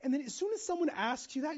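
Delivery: ordinary talk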